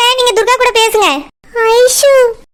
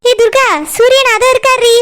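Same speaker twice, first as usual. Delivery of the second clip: ordinary delivery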